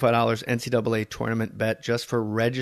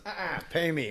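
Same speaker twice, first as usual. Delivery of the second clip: Goodfellas mobster; 5 semitones higher, 5 dB quieter than usual. Retold or imitated. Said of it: "As they say in Goodfellas".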